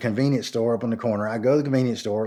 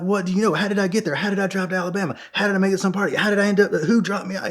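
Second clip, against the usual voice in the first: high, urgent volley of questions; retold frantic questioning; 9 semitones higher, 3 dB louder, faster than usual. Begging the laughing older guy for answers, high and frantic. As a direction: fire the questions off, pitched up and pleading.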